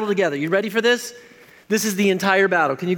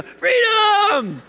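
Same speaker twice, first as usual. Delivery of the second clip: much higher, full-throated mock war cry; Braveheart battle cry; 11 semitones higher than usual. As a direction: belt it out like a movie hero charging. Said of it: "What did he yell at the end of Braveheart?".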